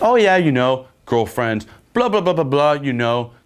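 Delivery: high-pitched, breezy mock small talk; playful role-play chatter. Man calling the girl his girlfriend, playful role-play chatter.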